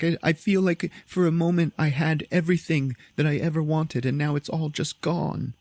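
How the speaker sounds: high, hammed-up tearful lament, slowing at the end; mock crying. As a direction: whine it as if weeping, half in earnest. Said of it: "and she's all crying".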